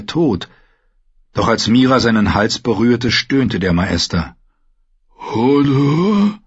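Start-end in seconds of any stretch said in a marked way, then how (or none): 5.2-6.4 drawn-out, higher, mournful call; sad. A narrator's reading. Hodor beside the fallen maester, sad. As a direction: stretch it into a sad question. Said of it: "sagte Hodor traurig".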